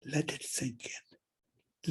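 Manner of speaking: slow hushed whisper, letting it land; whispered aside. Real, not acted. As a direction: breathe it out slowly so the point sinks in. Pause after history's lesson.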